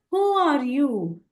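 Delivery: high-pitched acted question, falling away; insistent questioning. Hanuman questions the warrior-like strangers, insistently. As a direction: lift WHO high, let it fall.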